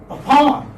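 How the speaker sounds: "upon" as uh-PON, high on "pon"; teacher modelling "upon" with a light first vowel; second-syllable stress. Shown as a demonstration. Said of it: "giving stress on the second syllable".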